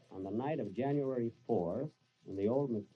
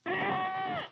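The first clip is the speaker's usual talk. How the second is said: startled scream, far higher and louder; frightened; 5 dB louder, much slower than usual. Acted. Master spooked by his valet in a sheet, frightened scream. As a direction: jump out of your skin.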